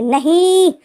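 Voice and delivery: sudden high, loud scream of refusal. Acted. Van Keging screams no at Jao Joshi, loud shriek. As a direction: shriek the word out, pushing him away. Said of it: "चीख कर बोलता है".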